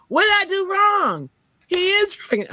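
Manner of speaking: put-on very high-pitched kid's protest; imitated loud child. Pebbles protests to mom in the store, loud child. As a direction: wail it like a wronged little kid. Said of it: "she's so loud".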